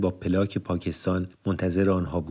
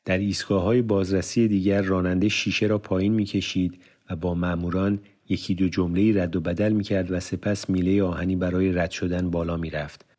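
ordinary delivery

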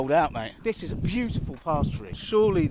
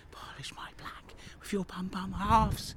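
slow eerie whisper; 3 semitones higher, 7 dB quieter than usual. Acted. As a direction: breathe it like a ghost. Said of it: "they're whispering".

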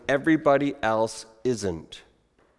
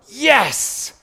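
jubilant higher yes; excited crowd reaction; 8 semitones higher, 4 dB louder than usual. Acted. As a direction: burst out as a thrilled crowd. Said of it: "anticipate in the reaction of the crowd".

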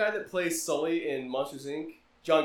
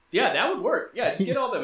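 higher, louder sarcastic yeah; mock agreement; 6 semitones higher, 3 dB louder than usual. The speaker mocking Thor's plan, sarcastic agreement. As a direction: play along, clearly unconvinced. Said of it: "I'm like".

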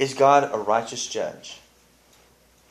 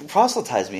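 higher, louder mock confrontation; confronting; 8 semitones higher, 5 dB louder than usual. Elaine confronting Putty at the episode's end, higher and louder. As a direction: throw it at him as an accusation. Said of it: "she confronts him on it".

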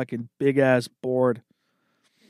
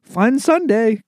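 mocking singsong; 7 semitones higher, slower than usual. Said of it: "taking a picture ... like".

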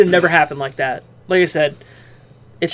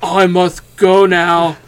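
slow, emotionless monotone; emotionless Bran voice; 2 semitones lower, much slower than usual. Retold or imitated. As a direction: drag each word out with no feeling. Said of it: "And the way that he talked".

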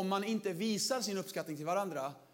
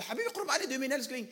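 loving pet names; 5 semitones higher, much faster than usual. Retold or imitated. Sweet talk to his wife.

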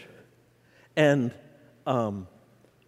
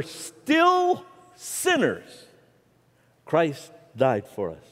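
scripture reading, stretched; much slower than usual. Real, not acted.

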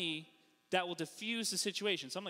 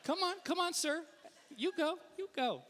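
much higher, mock-friendly urging; over-polite coaxing; 10 semitones higher than usual. Missouri driver waving another sir through, coaxing. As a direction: coax them along in a high, eager voice. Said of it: "you're there to make friends".

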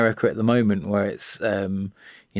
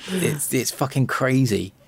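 ordinary delivery

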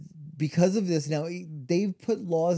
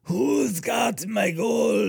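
put-on a miner's spirit demanding his gold, German-accented shout; German ghost shout; 8 semitones higher, much slower than usual. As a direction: howl it like an angry German ghost. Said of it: "the German".

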